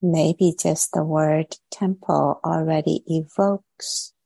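guided meditation. Real, not acted. Teacher settling online meditators into posture and body, guided meditation. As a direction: ease them gently into stillness, leaving long pauses between phrases.

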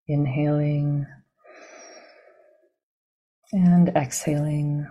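calm, measured meditation guidance with long pauses; guided meditation. Real, not acted. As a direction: guide them gently into the body, leaving silence between phrases.

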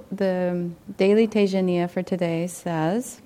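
normal talking voice

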